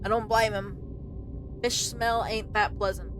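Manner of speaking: ordinary talk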